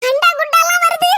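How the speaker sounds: high-pitched flustered exclaiming; alarmed dismay. Acted. Neighbours watch belongings float by in flood. (பதற்றமான உயர்ந்த குரல்)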